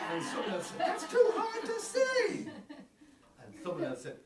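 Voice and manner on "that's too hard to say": put-on very high, slow squeal of a small child; high, slow child voice with a laugh; high squeaky child voice. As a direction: squeak it out like a tiny kid. Children's reaction voiced in story.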